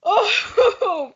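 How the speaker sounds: ordinary talk, nothing marked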